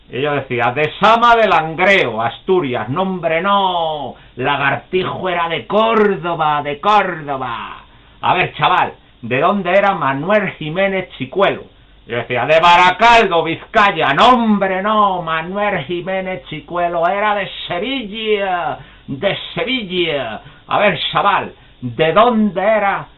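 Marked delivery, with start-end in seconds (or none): 0.7-2.9 deliberately wrong answer. Retold or imitated. 2.9-7.8 high, slow, louder lecturing correction; exasperated old man correcting. Retold or imitated. 8.2-11.6 put-on quizzing old man. 12.4-14.1 high, loud cheeky wrong answer; loud deliberately wrong answer. Retold or imitated. 14.1-20.4 high, slow, louder lecturing correction; exasperated old man correcting. Retold or imitated.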